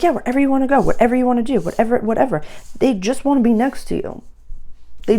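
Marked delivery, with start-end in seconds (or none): 0.0-2.4 higher, eager agreeable friend voice; mock people-pleaser friend; clingy yes friend. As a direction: gush along like a friend who agrees to anything. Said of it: "And they're like".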